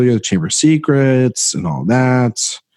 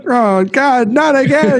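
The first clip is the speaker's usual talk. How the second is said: mock dread. Cohost dreading the tear-jerking word, high mock whine. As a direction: whimper like the word will wound you.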